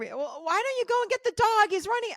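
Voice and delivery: hammed-up impatient husband; imitating husband. Husband voiced, demanding help.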